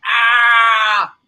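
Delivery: loud, high yell of pain, imitated; pained shout. Biker hit by the truck, yelling in pain. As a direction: belt out the biker's yelp as he's hit. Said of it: "the guy was like".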